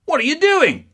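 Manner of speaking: high, loud outraged father; angry father. Retold or imitated. Father bursting in on the couple, high loud outrage. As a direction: burst in yelling at them. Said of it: "her father burst in and he goes".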